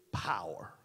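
hushed, breathy drop on the last word; a sudden stage whisper for effect; dramatic whisper. Acted. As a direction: let the word power fall to a whisper. Preacher drops to a whisper.

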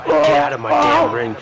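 angry shout. Acted. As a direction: scream him out of the ring. Player yelling at rival wrestler.

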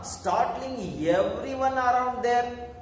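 normal talking voice

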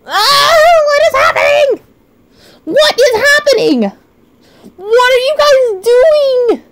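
high, exasperated cry; bewildered wail. Real, not acted. Streamer overwhelmed by chat's gifts, bewildered high wail. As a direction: plead in high-pitched disbelief.

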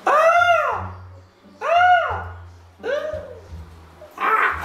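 loud high pain scream; burning scream. Real, not acted. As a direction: scream from the spicy burn. Burning from the hot sauce.

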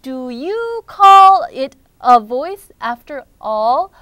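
very slow, loud, stretched "Do you call it a voice after all?"; dramatic lament. Acted. Rookie doubts his own voice, slow loud lament. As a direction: draw out "call" in loud despairing protest.

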